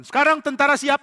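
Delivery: king commanding soldiers; barked command. Acted. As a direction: call the troops to attention.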